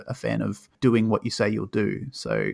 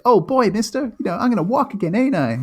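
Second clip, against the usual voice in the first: hammed-up saccharine Disney boy, very high; mock an upbeat, sweet kid in a high, loud voice; Bobby; 11 semitones higher, 4 dB louder than usual. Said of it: "He's just so upbeat and sweet".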